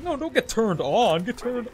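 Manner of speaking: high, drawn-out mock insistence; imitated protest. Other player correcting him, imitated high protest. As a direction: insist on it, stretching the words. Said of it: "He's like".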